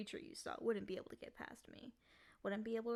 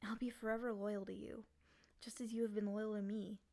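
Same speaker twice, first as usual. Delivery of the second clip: normal talking voice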